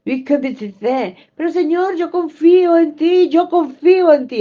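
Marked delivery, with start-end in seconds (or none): 1.3-4.4 imitating a believer, raised and loud; high, loud mimicked claim of trust; mock believer's claim. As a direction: parrot the believer's loud insistence. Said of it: "Así dicen".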